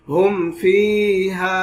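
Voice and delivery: "হুম ফিহা" slow, short clean m and long vowels; clear meem, no ghunna. Shown as a demonstration. Quran teacher showing izhar, slow and clear. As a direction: stretch "ফিহা", keep the m of "হুম" unhummed. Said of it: "গুণনা ছাড়া স্পষ্ট করে".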